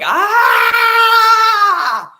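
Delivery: a long, loud, high open yell; release yell. Shown as a demonstration. Showing viewers how to release it, loud open yell. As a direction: let it all out in one big yell. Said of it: "if you just feel like".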